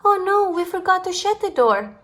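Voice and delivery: ordinary delivery, nothing marked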